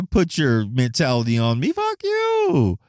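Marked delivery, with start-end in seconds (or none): 1.7-2.8 sudden high-pitched mock "fuck you" at naysayers; mock retort. Vlogger mocking people who push their opinions, high-pitched mock retort. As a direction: fling it back, squeaking up high. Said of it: "I don't tell 'em fuck you".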